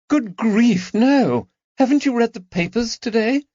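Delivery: high, fast astonished outburst; can't believe they haven't heard; incredulous lady. Acted. Lady Caroline astonished at her friends, high and quick.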